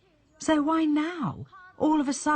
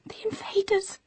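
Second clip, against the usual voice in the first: higher, near tears, breaking voice; terrified, crying words; panicked terror; 9 semitones higher than usual. Acted. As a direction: sob it out in panic. Wife panicking about invaders.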